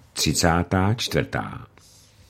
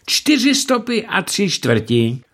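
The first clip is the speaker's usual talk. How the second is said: triumphant, a lot higher; delighted; 11 semitones higher than usual. A narrator's reading. Lam measuring the fattened monk, delighted. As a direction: announce it with glee. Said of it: "řekl rozradován".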